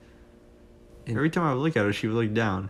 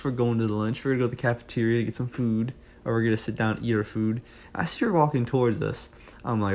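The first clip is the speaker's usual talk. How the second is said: plain reading voice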